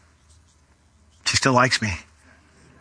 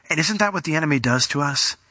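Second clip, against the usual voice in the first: ordinary talk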